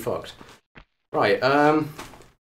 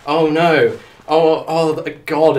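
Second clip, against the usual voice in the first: loud, raised cries of dismay; 4 semitones higher, 6 dB louder, slower than usual. Real, not acted. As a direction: react aloud in dismay. Reacting in dismay to the game.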